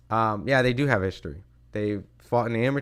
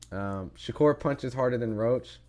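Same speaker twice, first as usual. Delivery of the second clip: ordinary talk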